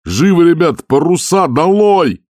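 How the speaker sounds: high, loud, slow frantic command; shouted order. A narrator's reading. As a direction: bellow at the crew over the storm. Skipper orders crew in panic. (капитан кричит команду матросам)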